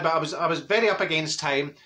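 ordinary delivery

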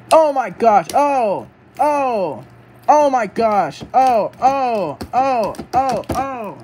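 high, repeated cries at each blow; shocked commentator. Pretend. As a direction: react to every hit as it lands. Commentator reacts to repeated blows.

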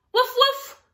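high, loud little puppy woofs; dog barks. Acted. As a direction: bark it like an excited puppy. Dog barks in picture book.

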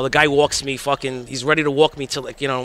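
ordinary talk

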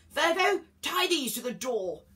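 loud, high commanding call; calling out orders. A narrator's reading. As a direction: shout instructions across the train. Zeus calls orders to Virgo.

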